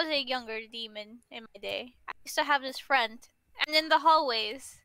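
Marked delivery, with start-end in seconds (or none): none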